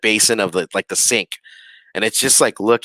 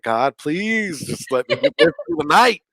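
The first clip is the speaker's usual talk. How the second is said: higher, pleading little prayer under the blanket; quick scared prayer; 6 semitones higher than usual. Retold or imitated. Frightened guest praying under the blanket, higher and pleading. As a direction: beg God like a frightened kid. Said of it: "I say a quick prayer".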